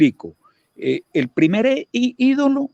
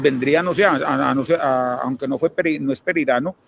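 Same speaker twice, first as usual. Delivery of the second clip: ordinary talk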